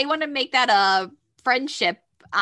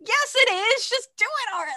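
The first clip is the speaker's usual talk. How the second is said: much higher squealing plea; high squealing crowd; 13 semitones higher than usual. Retold or imitated. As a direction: squeal it like a fan. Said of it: "everyone from the audience is like".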